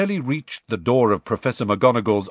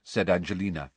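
usual speaking voice

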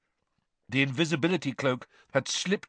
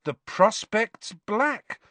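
slow, high, dread-filled recitation; horror-struck recital; 9 semitones higher, much slower than usual. A narrator's reading. Harry reciting the clue while staring at the elf, horror-struck. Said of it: "Harry recited, staring, horror-struck".

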